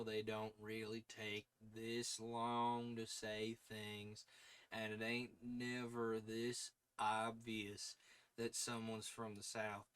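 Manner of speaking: slow, lightly drawn Southern voice; Southern drawl. Shown as a demonstration. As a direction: drag the words out Southern style. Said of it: "talk this way".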